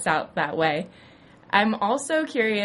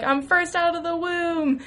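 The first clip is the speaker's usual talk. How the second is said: much higher, smug proud declaration; smug claim; 10 semitones higher than usual. Retold or imitated. Dee claiming the bar from Charlie, smug and much higher. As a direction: announce it like a prize. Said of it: "Dee's like".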